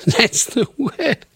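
high, slow words through laughter; laughing. Real, not acted. Host finally finds the word after fumbling it, laughing.

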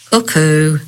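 chirpy cuckoo call; cuckoo-clock call. Acted. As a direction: coo it like a clock bird. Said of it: "the vampire giraffe said".

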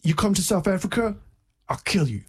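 mock threat in a lightly put-on African accent; imitated African man. Retelling a man's threat at the kiosk, in an African accent. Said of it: "an African guy".

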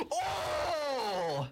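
high, long "oh" of horror; shocked group groan. Acted. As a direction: wail a drawn-out reaction to a gory hit. Toys react to monster cut in half.

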